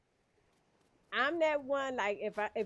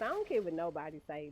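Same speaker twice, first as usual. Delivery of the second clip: normal talking voice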